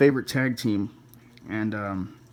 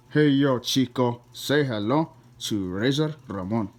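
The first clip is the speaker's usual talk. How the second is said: slow, drawn-out wrestler catchphrase; Razor Ramon impression. Wrestler introducing himself on a DVD cover, slow swaggering impression. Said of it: "There's Scott Hall".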